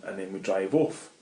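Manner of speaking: ordinary delivery, nothing marked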